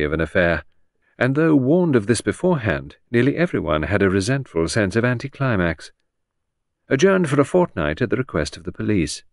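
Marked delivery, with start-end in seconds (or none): none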